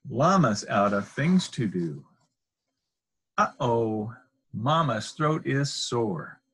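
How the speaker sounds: ordinary delivery, nothing marked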